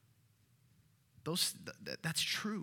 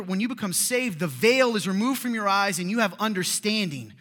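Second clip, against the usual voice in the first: loud, higher preaching with rising passion; fervent preaching; 6 semitones higher, 8 dB louder than usual. Acted. Preacher urging the congregation, loud and fervent. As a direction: preach it hard to the room.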